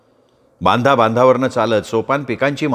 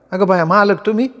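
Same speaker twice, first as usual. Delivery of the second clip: put-on higher, startled rustic girl; surprised village girl; 8 semitones higher than usual. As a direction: react to the master's sudden visit. Young woman surprised at master. (अचानक मालक पाहून चकित तरुणी)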